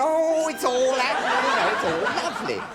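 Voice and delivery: gushing delight. Acted. Imagined guest sitting back with the interviewer, hammed-up delight. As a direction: swoon theatrically with contentment. Acting people relaxing with the interviewer.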